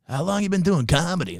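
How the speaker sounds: a hint of his way of talking; imitating John Roy. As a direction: comedian backstage asks him, lightly imitated. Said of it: "That's how he talks".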